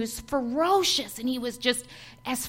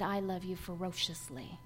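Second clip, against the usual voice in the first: voice of God; 6 semitones lower, 11 dB quieter than usual. Acted. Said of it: "And he said".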